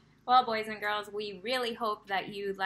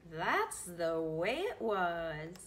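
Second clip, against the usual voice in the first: gentle ending; 4 semitones lower, much slower than usual. A narrator's reading. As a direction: bring the story home. Story ending line.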